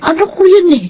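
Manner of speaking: mother bragging about her son; proud gush. Acted. (masayang pagmamalaki)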